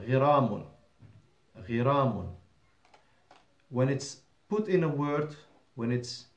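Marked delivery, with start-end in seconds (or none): 0.1-2.3 "غرام" as ghi-ra-mun, short i after gh; ghain with kasra. Shown as a demonstration. Teacher shows the standalone word, "غرام" pronounced ghiramun. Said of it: "it's with the kasra".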